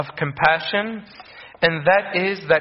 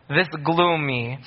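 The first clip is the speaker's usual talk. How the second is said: ordinary talk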